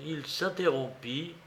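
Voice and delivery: plain reading voice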